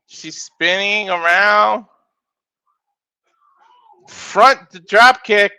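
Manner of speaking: rapid ringside calls rising on big moves; live play-by-play. Acted. Commentator calling a wrestling match to viewers, energetic play-by-play. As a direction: call the match as it happens.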